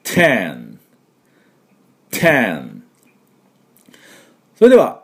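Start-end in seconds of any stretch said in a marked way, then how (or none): 0.0-2.8 "ten" with a popped t and a held n; explosive t, long n on "ten"; model pronunciation. Shown as a demonstration. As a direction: say "ten" alone and carefully, falling, for learners to repeat. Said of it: "私の後について発音いきましょう".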